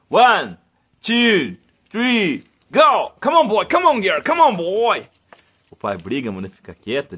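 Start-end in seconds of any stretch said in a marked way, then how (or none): 0.1-5.0 loud high countdown and calls; excited calling. Real, not acted. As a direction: cheer the dogs on. Releasing dogs again.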